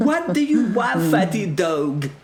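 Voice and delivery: very high, loud outraged voice; irate foreign herald. Acted. Herald insults the knight, high furious shout. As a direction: shriek it as a comically furious herald.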